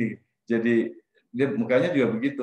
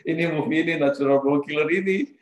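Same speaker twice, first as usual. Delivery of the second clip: higher, louder, stunned echo of the title; shocked repeat; 4 semitones higher, 3 dB louder than usual. Real, not acted. Teller recalling his shock at the film, surprised. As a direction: react in disbelief to the film's name.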